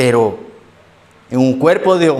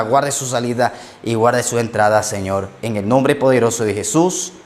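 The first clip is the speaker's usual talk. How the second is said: earnest prayer; 4 semitones lower than usual. Acted. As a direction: pray over the congregation, gently and steadily. Preacher leads closing prayer.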